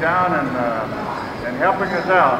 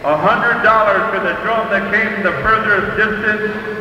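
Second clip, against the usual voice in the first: slow, measured arena announcer; powwow emcee; 5 semitones higher than usual. Real, not acted. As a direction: call out names to the crowd.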